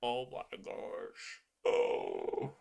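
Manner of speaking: breathy near-whisper, slow; hushed dismay. Real, not acted. Groaning quietly at a weak card. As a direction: let it drop to a stunned hush.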